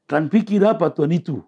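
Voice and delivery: blunt Batak-style challenge. Retold or imitated. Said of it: "Orang Batak gitu".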